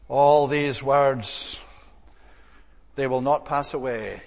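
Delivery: each word drawn out very slowly; stretched-out reverent reading of the promise; slow and solemn. Real, not acted. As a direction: let the scripture land word by word. Solemn restatement of scripture.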